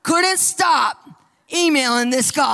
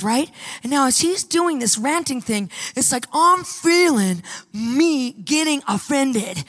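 usual speaking voice

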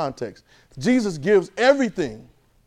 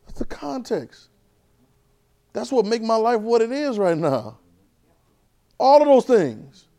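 slow emphatic preaching; 7 semitones higher, 5 dB louder, slower than usual. Acted. Preacher slowly hammers home his point to the church. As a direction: drag each word out, raised.

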